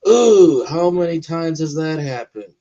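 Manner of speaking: loud, high "ooh"; emphatic wince of surprise; impressed reaction. Real, not acted. Reacting to a dark scene.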